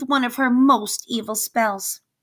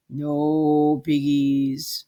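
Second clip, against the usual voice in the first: slow cow voice; 6 semitones lower, much slower than usual. Acted. Cow answers in slow low voice.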